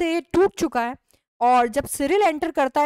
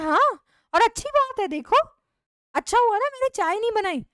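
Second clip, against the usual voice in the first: defiant retort; 8 semitones higher than usual. Acted. Wife retorts to husband.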